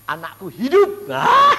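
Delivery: loud, rising joyful cry with a laugh. Retold or imitated. Father hearing his child lives, loud joyful cry. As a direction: burst out in relief.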